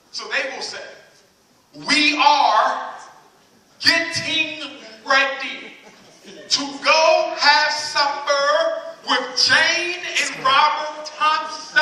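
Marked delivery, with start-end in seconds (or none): 1.7-11.8 very slow, every word carefully cut apart; crisp proper diction, hammed up and slow. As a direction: spell out each word like it's a formal dinner invite. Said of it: "all about enunciating the words".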